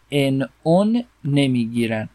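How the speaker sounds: ordinary delivery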